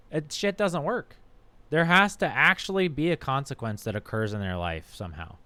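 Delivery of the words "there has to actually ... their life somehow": ordinary delivery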